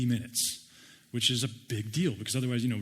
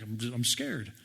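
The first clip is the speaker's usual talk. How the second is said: mock scared; 3 semitones lower, 4 dB quieter, slower than usual. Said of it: "so we're like".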